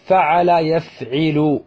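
فعل يفعل slowly, ending yaf-'i-lu; middle vowel I. Shown as a demonstration. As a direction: sound out يفعل with a held I after the ayn. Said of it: "مضارعه يكون بكسر العين".

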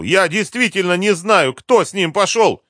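high, loud desperate denial; crying out. A narrator's reading. Captive dodges the colonel's blow, crying out. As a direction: shout it while dodging a blow. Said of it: "закричал".

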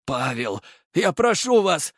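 pleading, pitch leaping up after the name; desperate father's entreaty; faltering plea. A narrator's reading. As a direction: beg him, voice wavering. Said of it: "его голос дрогнул".

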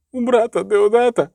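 put-on high, distraught young monk; tearful outcry without much volume; crying out. A narrator's reading. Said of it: "викрикнув ченчик".

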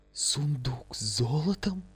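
hushed, drawn-out delighted wonder; joyful exclamation. A narrator's reading. Лиза sees the chest of gold, joyful amazement. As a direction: breathe it out slowly, delighted and amazed. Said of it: "воскликнула она с радостью".

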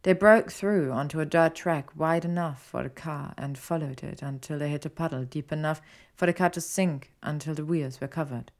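usual speaking voice

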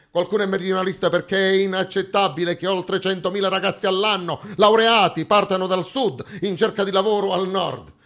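ordinary delivery

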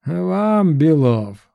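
high mocking smirk. A narrator's reading. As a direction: sneer it out with a smirk. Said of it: "усмехнулся Мясников".

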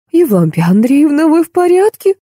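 high, alarmed housekeeper's question; frightened. A narrator's reading. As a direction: pitch it high with worried alarm. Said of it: "испугалась Краузе". (испуганно, высоким голосом)

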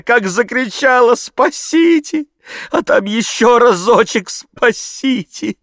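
high, loud, drawn-out wail; tearful voice. A narrator's reading. Maid answers the gentleman, tearfully wailing. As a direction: wail like a distraught peasant maid. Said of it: "заговорила слезливым голосом".

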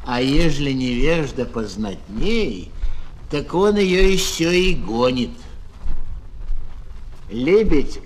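ordinary delivery

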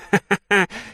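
high crazed hag laugh; mad cackle. Acted. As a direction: break into a crazy cackle. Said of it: "разошлась безумным смехом". (безумный старушечий смех)

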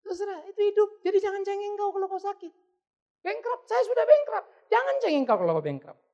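high-pitched exhortation, loud in bursts; teguran keras. Acted. Preacher scolds listeners against whining. (menegur keras dengan nada tinggi)

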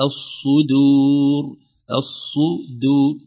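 الصدور with a clean د, not ض, slowly; clear dal, slow. Shown as a demonstration. Teacher modelling the correct د slowly. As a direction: drop the tongue straight to a clear د in الصدور. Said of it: "الصحيح".

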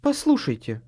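high, insistent call for attention; woman interrupting. A narrator's reading. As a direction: cut in with a woman's voice. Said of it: "вмешалась в их разговор женщина".

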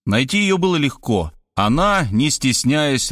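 plain reading voice

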